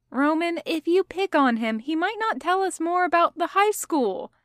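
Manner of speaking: gently pointed scolding. A narrator's reading. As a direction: make the point firmly. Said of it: "Patton said pointedly".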